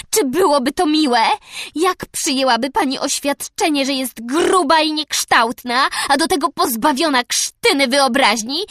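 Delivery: put-on high, loud furious child; raging girl. A narrator's reading. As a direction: pour out every grievance. Anne raging at Mrs Lynde.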